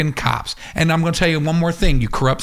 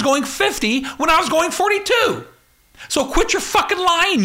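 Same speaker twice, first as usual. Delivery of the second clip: furious rant; 10 semitones higher than usual. Real, not acted. Ranting at corrupt police.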